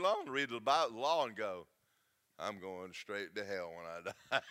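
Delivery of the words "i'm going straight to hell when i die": laughing mock despair. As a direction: laugh through a lowered mock wail. Mock sinner's lament, laughing.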